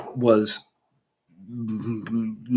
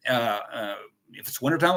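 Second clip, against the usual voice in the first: ordinary talk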